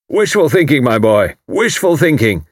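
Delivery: loud, high hearty military man; retired commander teasing his neighbour, loud and hearty; bluff old commander. A narrator's reading. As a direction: boom it out like a blustering retired officer. Haydock voiced.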